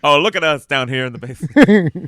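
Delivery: loud, high, laughing manic voice; hammed-up Robin Williams greeting. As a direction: burst in like a giddy improv comic. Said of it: "I gotta stop doing this voice now".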